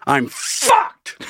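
squeaky high I'm fucked; a high, defeated little wail; high, strangled realization; mock despair. Despairing inner thought.

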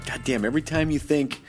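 normal talking voice

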